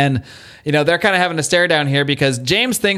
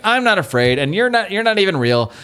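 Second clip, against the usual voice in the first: higher-pitched, half-hearted retold scream; mock scream; 6 semitones higher than usual. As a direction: imitate a scared kid's scream without the volume. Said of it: "he's just sc-, screaming".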